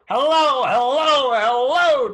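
booming, high hello; loud greeting. Acted. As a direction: call it out to the crowd. Host greeting repeated.

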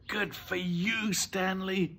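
high, slow motherly praise; praising. Acted. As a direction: praise your child, drawing it out. Mother praises son for finding ring.

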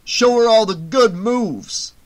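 loud, drawn-out cheer; egging on. A narrator's reading. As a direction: holler it slowly, egging your friend on. Crony loudly eggs Fox on.

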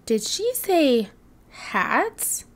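dawning surprise. Acted. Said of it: "said Digger".

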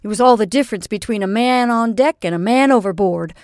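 loud, rising, pressing sea captain; earnest outburst. A narrator's reading. Captain pressing Missus Trimmer with his feelings, loud and urgent. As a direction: pour it all out at her.

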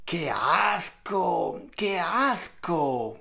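slow, soft disgusted groan. Real, not acted. Reacting to the socks story, slow soft disgust. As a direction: drag out the disgust.

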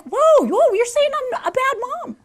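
high, loud indignant whoa; playful high indignant mom; mock offended mom. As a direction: act shocked and hurt. Mom offended by kids' begging.

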